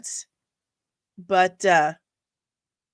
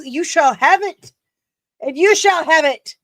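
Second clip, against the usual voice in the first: higher, louder, grand proclamation voice; mock royal decree; 8 semitones higher, 9 dB louder than usual. Vlogger promising a fan a gift, in a mock-regal higher voice. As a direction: grant the wish like a hammy queen.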